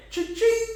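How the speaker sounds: high sing-out cha-ching; playful cash-register squeal. Pretend. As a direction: imitate a till ringing, very high.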